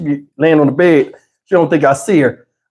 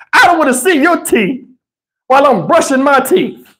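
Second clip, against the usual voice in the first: mock wife; 10 semitones higher than usual. Mocking wife's complaint.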